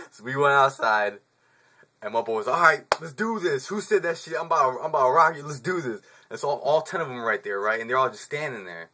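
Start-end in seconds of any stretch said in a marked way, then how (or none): 2.5-6.0 ready to fight. Retold or imitated. K calling out the fighters.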